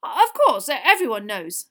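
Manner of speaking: loud, flustered stammer; discomfited mutter. A narrator's reading. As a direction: agree hastily and awkwardly. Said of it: "muttered Di-, Mister Diggory".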